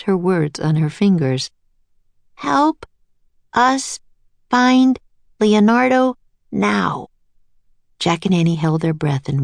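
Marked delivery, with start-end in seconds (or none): 2.3-7.1 slow deliberate wish. Acted. Casting a wish with wand.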